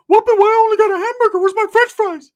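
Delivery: high, loud, fast complaint; Ricky's whiny voice. Retold or imitated. Ricky demanding his fries in a high, loud whine. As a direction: whine like a cheated kid. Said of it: "Ricky would be like".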